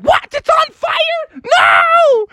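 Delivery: very high screeched mom voice; panicked mother. Acted. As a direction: shriek in hammed-up alarm. Mother panics at shouted fire.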